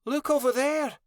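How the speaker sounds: very high, loud pup voice; high-pitched, frightened urging; whimpering. A narrator's reading. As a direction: point it out in high alarm. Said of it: "Lottie whimpered".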